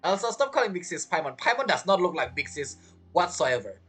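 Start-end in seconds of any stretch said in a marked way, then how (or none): none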